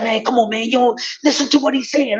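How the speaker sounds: pleading objection. Retold or imitated. Friend urges him to listen, mock pleading.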